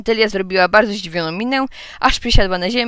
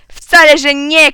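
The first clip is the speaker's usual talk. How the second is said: very loud, high contradiction; shrieked denial; 6 semitones higher, 10 dB louder, slower than usual. A narrator's reading. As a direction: burst out at full voice. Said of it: "krzyczasnęła Otylia". (bardzo głośny, wysoki wrzask)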